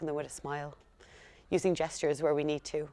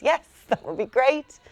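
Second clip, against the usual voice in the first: eager yes; 9 semitones higher, 11 dB louder than usual. Retold or imitated. Her own eager answer to parents.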